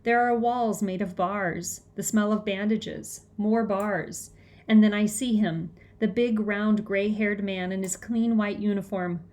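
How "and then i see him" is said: usual speaking voice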